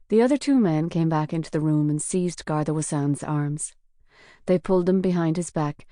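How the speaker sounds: plain reading voice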